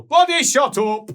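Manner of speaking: dwarf shout. Acted. Dwarf woman shouting at the chattering dwarves, loud. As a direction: shout them down. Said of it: "she shouts out".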